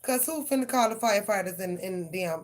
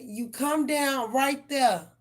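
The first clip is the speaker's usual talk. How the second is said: stern command; 6 semitones higher, 4 dB louder, much slower than usual. Retold or imitated. Ordering cat down from tree.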